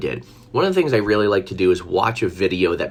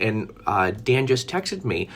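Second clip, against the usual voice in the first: normal talking voice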